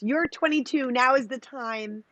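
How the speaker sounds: high-pitched, emphatic encouragement, retold; urging mother. Mother urging daughter into business, imitated encouragement. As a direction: imitate a mother pushing her daughter forward.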